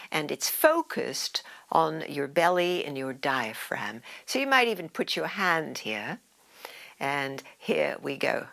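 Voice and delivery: ordinary talk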